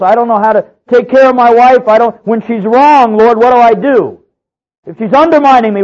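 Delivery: high, loud, desperate cry to the Lord; anguished plea to God. Acted. Preacher voicing a husband crying out to God, loud and desperate. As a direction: wail it up at heaven, begging for help.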